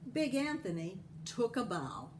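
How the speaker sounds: plain reading voice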